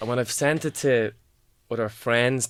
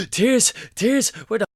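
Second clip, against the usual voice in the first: poem recital; 8 semitones higher, 7 dB louder, slower than usual. Acted. Performs poem Panic Attack.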